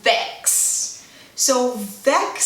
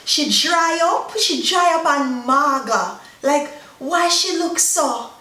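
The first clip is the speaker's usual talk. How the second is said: higher-pitched Jamaican patois exclaiming; 3 semitones higher than usual. Acted. Jamaicans remarking on someone too skinny, in exclaiming patois. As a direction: remark in Jamaican patois with drawn-out, lifted phrases. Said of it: "some Jamaicans will even go a step further and say".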